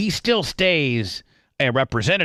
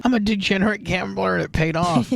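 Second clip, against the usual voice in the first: mock McCarthy, higher; 7 semitones higher than usual. Mocks McCarthy's story about gambling.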